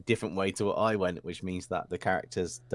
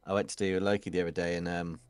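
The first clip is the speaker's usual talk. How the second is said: ordinary delivery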